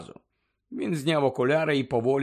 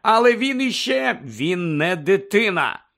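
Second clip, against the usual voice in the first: raised-voice angry objection; higher, heated retort; angry start; 6 semitones higher, 6 dB louder than usual. A narrator's reading. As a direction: break in crossly, loud. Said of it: "почав сердити Сіріус".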